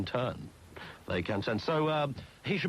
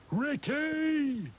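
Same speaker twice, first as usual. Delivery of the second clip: high, put-on soap opera yell; mock calling out; 12 semitones higher than usual. Host mimics an EastEnders character, calling out high. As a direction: call the name out high, as an imitation.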